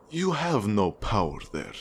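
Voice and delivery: usual speaking voice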